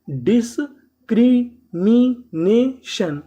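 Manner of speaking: Discrimination stretched very slowly, syllable by syllable; slow teacher model. Shown as a demonstration. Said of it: "discrimination का मतलब".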